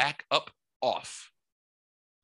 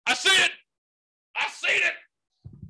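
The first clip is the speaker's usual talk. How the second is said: very high mock neighbor voice, slowing down; neighbors' squeaky voice; 3 dB louder than usual. Neighbors confirming the sight, in a very high squeaky voice. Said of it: "fucking go".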